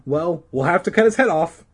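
high, loud, firm verdict; insisting. Acted. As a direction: insist on it to a friend. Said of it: "Regina insisted".

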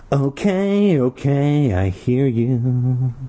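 put-on high, slow grudging kid voice; Jelly Boy giving in to the barker, high; Jelly Boy muttering. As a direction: grumble it back reluctantly. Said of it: "the Jelly Boy muttered".

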